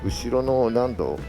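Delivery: ordinary talk, nothing marked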